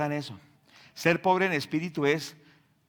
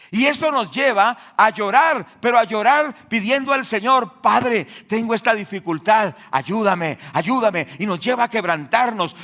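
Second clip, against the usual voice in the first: loud, higher, urgent sermon voice; fiery preaching; 4 semitones higher, 8 dB louder than usual. Acted. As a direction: preach it at full force. Preaching at full force.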